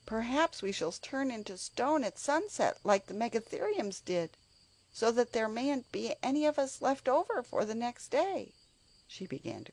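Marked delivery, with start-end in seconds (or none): 0.1-8.5 frightened. A narrator's reading. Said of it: "cried Anthea suddenly".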